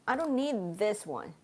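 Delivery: ordinary delivery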